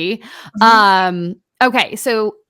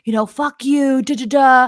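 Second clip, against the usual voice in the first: higher-pitched mock of the aggressive guy; mock angry stranger; 5 semitones higher than usual. Imitating the gym stranger confronting her, lightly mocking. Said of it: "homeboy comes back and he's like".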